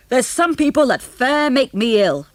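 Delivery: great-grandad storming out in disgust; angry old grandad. Acted. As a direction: spit it out like a disgusted old farmer.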